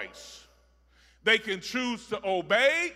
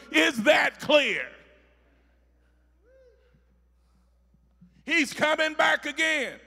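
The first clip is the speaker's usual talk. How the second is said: loud, climbing preacher shout; full-force sermon delivery; preaching at full force; 6 semitones higher, 5 dB louder than usual. Real, not acted. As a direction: thunder it over the congregation.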